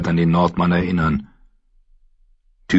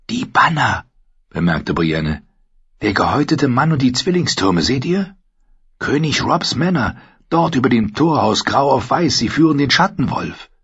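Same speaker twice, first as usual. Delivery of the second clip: lightly raised, earnest woman; a hint of a stern young warrior woman; 6 semitones higher than usual. A narrator's reading. Narrator voices Brienne.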